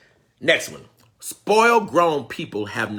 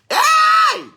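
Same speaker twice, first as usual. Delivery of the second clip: high loud "Hey!"; shout; 10 dB louder than usual. Real, not acted. Preacher shouting out in excitement, high and loud.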